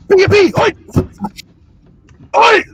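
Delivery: high loud oi cheer; shouted cheer. Acted. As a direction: bellow it like a rally cry. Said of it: "shouted cheer".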